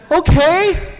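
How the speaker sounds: put-on high, loud nervous "okay" of a child; mock a jumpy kid's high, loud reply. Child answers babysitter's warning on the dog pile, nervous. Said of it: "stood up really nervous and said".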